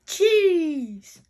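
loud, high delighted shout; big storybook exclamation; Grandpa Rabbit. Acted. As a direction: shout it out in one long falling cry. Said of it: "says Grandpa Rabbit".

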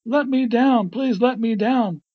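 high, loud begging to be released; shouted plea. A narrator's reading. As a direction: plead at the top of the voice. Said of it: "shouted Bugs".

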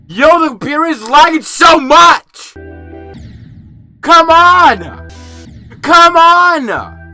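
high, loud frustrated yelling; frustrated shouting. Real, not acted. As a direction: yell at the lagging game. Game lagging, frustrated.

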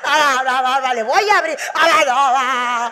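loud, laughing panicked cries; panicked child. Retold or imitated. Child panics when mother says the owl has arrived. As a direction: shriek in mock fear.

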